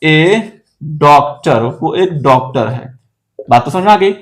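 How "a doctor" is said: each word spaced out slowly for students; slow, word-by-word dictation. Shown as a demonstration. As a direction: dictate it one word at a time. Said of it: "sir आप क्या बोल रहे हैं". (धीरे-धीरे, एक-एक शब्द बोलकर)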